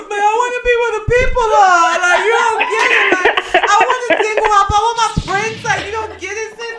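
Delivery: high, loud hammed-up sobbing; hysterical wailing. Defending her tears to her sister, hammed-up hysterical wail. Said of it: "and I'm hysterical".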